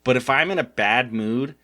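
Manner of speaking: ordinary talk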